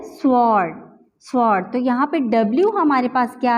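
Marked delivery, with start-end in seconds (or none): 0.0-1.7 स्वॉर्ड with a silent W, like sord; स्वॉर्ड said with no W sound; correct pronunciation demo. Shown as a demonstration. Teacher models sword correctly for students. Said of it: "this is actually silent".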